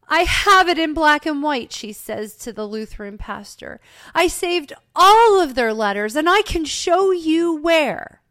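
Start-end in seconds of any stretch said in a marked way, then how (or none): 0.1-1.7 loud, high-pitched acted protest; indignant insistence. A narrator's reading. Indignant woman arguing with pastor. 4.2-8.2 loud, high-pitched acted protest; indignant insistence. A narrator's reading. Indignant woman arguing with pastor.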